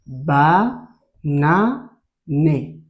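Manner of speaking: "Ba na né" very slow, in three separate beats; syllable by syllable. Shown as a demonstration. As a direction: sound out "Ba", "na", "né" with gaps. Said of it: "Répète avec moi". (« Ba na né » découpé syllabe par syllabe, très lentement)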